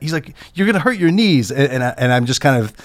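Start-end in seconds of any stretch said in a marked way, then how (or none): 0.5-1.5 a touch higher, mock-scolding warning; lightly voiced fussing Larry; mild Larry impression. Larry warns cameraman about his knees, light mock scold. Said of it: "he started yelling at me".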